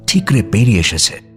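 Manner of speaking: usual speaking voice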